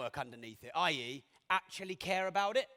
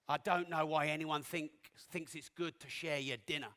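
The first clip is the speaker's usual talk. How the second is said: ordinary delivery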